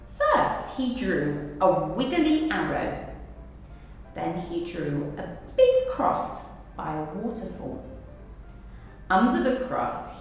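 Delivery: plain reading voice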